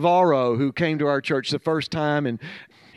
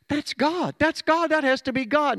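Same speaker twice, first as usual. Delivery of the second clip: much higher, astonished cry of recognition; amazed exclamation; 10 semitones higher than usual. Retold or imitated. Newcomer stunned that the vision matches his text, amazed higher exclamation. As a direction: exclaim it in amazement, pitch leaping up.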